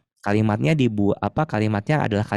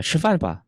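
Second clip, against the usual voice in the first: 吃饭吧 pitched high, as a casual urge; everyday Mandarin urging on "吃饭吧"; 8 semitones higher than usual. Shown as a demonstration. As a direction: say "吃饭吧" high, nudging someone to eat. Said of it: "yang tadi ya kayak".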